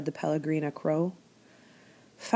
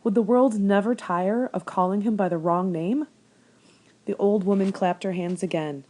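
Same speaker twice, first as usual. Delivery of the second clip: plain reading voice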